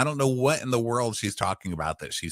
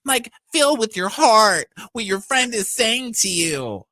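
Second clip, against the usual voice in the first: mock preachy; 7 semitones higher, 6 dB louder than usual. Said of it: "Heather's like".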